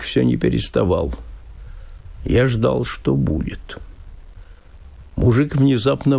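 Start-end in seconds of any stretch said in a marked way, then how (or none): none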